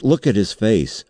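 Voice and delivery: mildly excited accusing cry; exclaimed. A narrator's reading. Narrator exposing Raikes to the board, exclaimed. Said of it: "I exclaimed".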